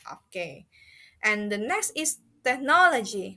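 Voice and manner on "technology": "technology" with NO stressed; stress on middle syllable. Shown as a demonstration. Teacher introduces the next word, "technology" stressed on NO. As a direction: lean on NO in "technology". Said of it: "middle emphasis on the middle syllable".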